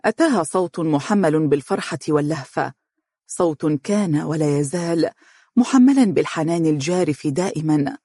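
ordinary delivery, nothing marked

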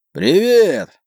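high, strained hoarse hail; hoarse shouted reply. A narrator's reading. Friend answers the captain from the other ship, hoarse shout. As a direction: call back hoarsely, drawing out the word. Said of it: "хрипло ответил тот".

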